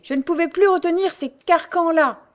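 high, loud gruff coachman; coachman grumbling. A narrator's reading. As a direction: grumble with relief after the near miss. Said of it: "grommela le cocher". (cocher qui grommelle, voix haute et forte)

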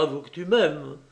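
usual speaking voice

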